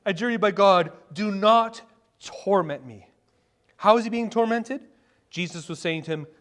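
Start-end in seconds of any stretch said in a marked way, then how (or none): 0.1-3.1 loud acted plea, slowing to heavy emphasis; crying out. Preacher voicing the demon begging Jesus, crying out. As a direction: cry it out, leaning on each last word. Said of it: "he crying out with a loud voice".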